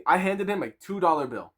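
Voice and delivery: normal talking voice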